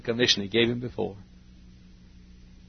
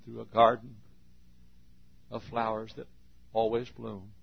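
gentle prayer voice; closing prayer. Real, not acted. Preacher praying to the Father at the end, gentle.

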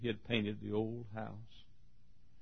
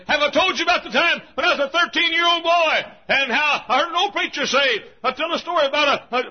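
high, loud revival-preacher shout; full-force preaching; 14 dB louder than usual. Acted. Old doctor retelling his boyhood sermon story, shouted high.

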